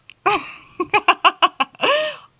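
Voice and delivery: loud high laughing shriek. Real, not acted. To her viewers, a loud shrieking laugh. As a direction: cackle out loud.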